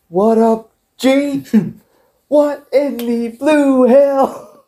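very high, drawn-out wrestling promo voice; The Rock. Acted. Quoting a favourite Rock promo, high mock wrestler voice. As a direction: stretch it out high, then drop for the sign-off. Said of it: "the best Rock promo ever".

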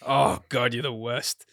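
ordinary talk

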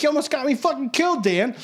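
high-pitched retold outrage; exasperated scolding. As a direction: act out telling off a friend after a close call. Bouncer scolds friend after near fight.

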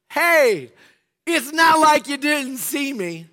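high, slow, mock-innocent jab; sarcastic retort. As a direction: needle him with fake innocence. Retold sarcastic remark to driver.

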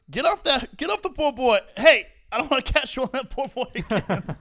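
loud high scolding mom; scolding mother. Retold or imitated. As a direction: yell like a mother scolding kids. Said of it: "Some mother".

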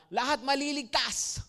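lightly voiced, mocking "Lahat maliligtas"; mocking quote. Preacher mimicking the universalist pastor, lightly mocking. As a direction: parrot "Lahat maliligtas" as a claim you don't believe. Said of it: "na sinasabi".

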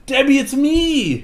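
high, bright calling out; excited call. Retold or imitated. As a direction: burst in eagerly calling her name. Darren bursting in on Debbie.

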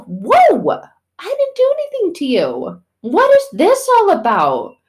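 very high, loud, hammed-up bewilderment; mock startled protest. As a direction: recoil in squeaky disbelief, pleading innocence. Mom startled by daughter's attitude.

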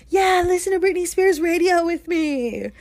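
high, bubbly sales pitch; mock party invite. Handing strangers her AirPod, mock peppy invite.

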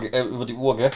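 ordinary talk, nothing marked

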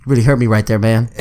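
a low, deep mock-wounded bro voice; mock hurt bro. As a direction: drop the voice way down and play it hurt. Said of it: "And then he was like".

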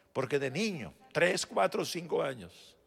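ordinary talk

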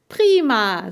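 high, loud exclamation; delighted. A narrator's reading. Boy is allowed to come along, delighted. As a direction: burst out gladly.

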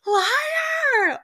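high, loud startled shriek of Liar; shocked scream. Retold or imitated. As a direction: yell it in disbelief. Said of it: "I screamed out loud".

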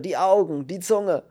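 high, loud distress; groaned horror. A narrator's reading. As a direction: recoil in horror. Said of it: "stöhnte der andere". (entsetzt gestöhnt)

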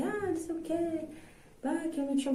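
imitating a sulky son, high and soft; rebellious kid. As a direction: put on a revolted child's high-pitched grumble. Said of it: "meu filho tá meio revoltado e fala assim".

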